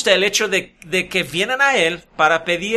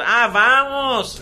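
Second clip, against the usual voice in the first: slow, higher teasing drawl; mocking laugh; 4 semitones higher, much slower than usual. Elías taunting the false prophets, slow and mocking. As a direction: draw it out like you're laughing at them. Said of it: "riéndose y burlándose de ellos".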